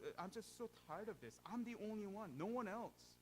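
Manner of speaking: loud, high complaining whine; whining Elijah. Retold or imitated. Elijah whines to God about being the only faithful one. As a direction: whine like a prophet in a tantrum. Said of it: "whining and complaining to God".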